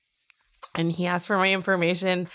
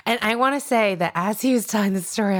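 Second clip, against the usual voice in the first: usual speaking voice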